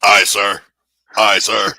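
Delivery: loud, crisp military acknowledgement, repeated; loud Marine aye sir. Retold or imitated. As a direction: snap back to an officer like a Marine. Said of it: "He's always".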